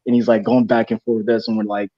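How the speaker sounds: usual speaking voice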